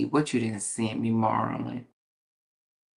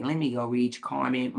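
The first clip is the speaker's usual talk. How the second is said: ordinary talk, nothing marked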